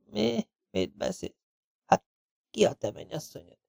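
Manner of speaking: weak, breathless disbelief; stunned faint whisper. A narrator's reading. Pale wounded Smith asks who the bride is, faintly. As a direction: gasp it out barely voiced.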